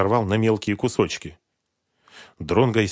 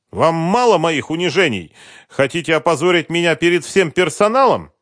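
loud, high-pitched outrage; indignant protest; 10 semitones higher, 9 dB louder than usual. A narrator's reading. Husband protesting a visit to his company, indignant. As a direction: lash out at the humiliation.

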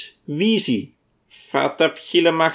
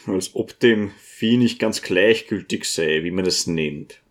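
feigned boredom; 7 semitones lower than usual. A narrator's reading. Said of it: "sagte der Propst scheinbar gelangweilt".

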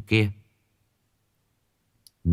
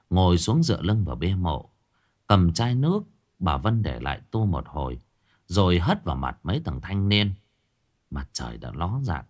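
usual speaking voice

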